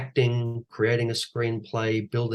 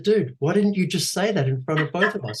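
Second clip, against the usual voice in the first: higher, annoyed retort; pitched-up incredulous complaint; exasperated; 8 semitones higher, 3 dB louder than usual. Retold or imitated. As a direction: challenge the director in disbelief. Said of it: "I said".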